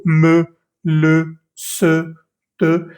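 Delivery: "me, le, se, te" each with a full French e; short French words with the e clearly pronounced; French e sounded. Shown as a demonstration. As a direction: say "me, le, se, te" separately, voicing the vowel. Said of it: "Ahí sí estamos obligados a pronunciar la E".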